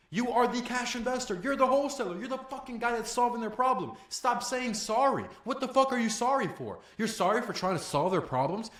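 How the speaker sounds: loud, high-pitched exasperated rant. Real, not acted. Coach telling callers to stop saying sorry, ranting. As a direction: berate them like a fired-up coach. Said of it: "I'm on a rant right now".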